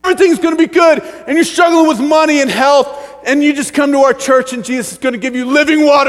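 high, loud, rolling excited promises; hammed-up evangelistic hype; mock sales pitch. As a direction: sell the church like a breathless convert. Church member's overexcited sales pitch.

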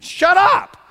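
a high, loud gunman's yell; retold scream. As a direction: bark it out as the gunman.

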